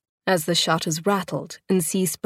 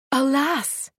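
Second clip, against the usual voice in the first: a higher, tearful cry; cried out; 8 semitones higher than usual. A narrator's reading. Keeper's wife crying out at the birth, tearful. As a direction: cry out with eyes full of tears. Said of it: "Effie cried".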